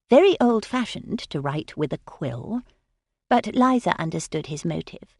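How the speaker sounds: ordinary delivery, nothing marked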